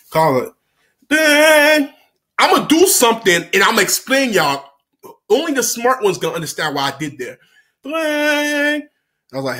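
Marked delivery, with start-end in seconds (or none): 1.1-1.9 high, loud, held ringing tone; imitated phone ring. Shown as a demonstration. Storyteller acts out the call, imitated phone ring. 7.8-8.8 high, held ringing note; imitated phone ring. Shown as a demonstration. Storyteller repeats the sound effect, imitated phone ring.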